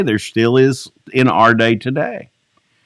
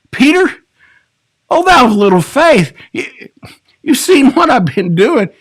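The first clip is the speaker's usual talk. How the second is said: higher, gently reassuring; tender rebuke; 9 semitones higher, 3 dB louder than usual. Retold or imitated. Pastor imagining Jesus gently reassuring sinking Peter, tender and higher. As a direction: reassure him like a loving friend. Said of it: "could Jesus have been saying".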